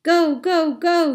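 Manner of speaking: high, loud repeated shout. A narrator's reading. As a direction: drive the horse forward. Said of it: "he shouted again".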